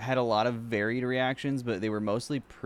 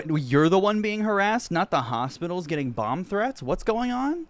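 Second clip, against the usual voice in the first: pitched-up exasperated retort; indignant disbelief, rising hard; incredulous outburst; 6 semitones higher than usual. Real, not acted. Streamer retorts at clip, incredulous.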